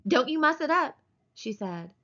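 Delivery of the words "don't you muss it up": high, scolding woman's protest; sharp warning. Acted. Wife warning him about her hair, scolding. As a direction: warn him off, pitched well up.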